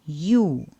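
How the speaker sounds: U said as you in university; Russian yu sound. Shown as a demonstration. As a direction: say it like the start of university. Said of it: "Please repeat".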